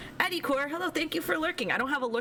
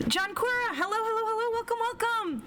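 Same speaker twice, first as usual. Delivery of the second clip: bright greeting; 12 semitones higher than usual. Real, not acted. Greeting a chat viewer.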